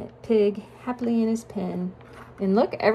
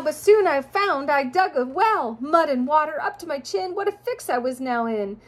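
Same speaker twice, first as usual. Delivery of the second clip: sing-song rhyming storybook read; reading to children; 5 semitones higher than usual. A narrator's reading. Parent reading a rhyming picture book to a child, sing-song. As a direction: lilt the rhymes for a child listener.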